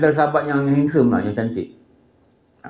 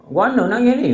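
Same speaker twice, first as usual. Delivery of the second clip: concerned father; 9 semitones higher, 3 dB louder than usual. Retold or imitated. Father questions his crying child.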